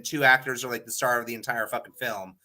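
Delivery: usual speaking voice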